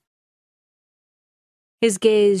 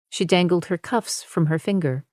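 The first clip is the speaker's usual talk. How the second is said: ordinary delivery, nothing marked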